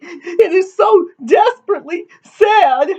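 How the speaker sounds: very high tearful wail; sobbing. A narrator's reading. Weeping daughter explains her grief, sobbing. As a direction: wail it like a storybook crybaby. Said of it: "sobbed Amity".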